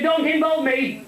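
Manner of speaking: high, slow indignant wife; drawn-out, high comic wife's protest; Paddy's wife. Acted. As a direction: wash your hands of it in a high voice. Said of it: "Said the Paddy's wife".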